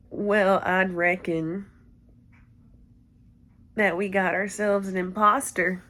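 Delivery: mock cowboy impression with a little twang; Boot Hill. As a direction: put on a drawling male cowboy voice. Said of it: "I just gotta make my voice deeper".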